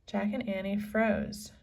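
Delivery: ordinary delivery, nothing marked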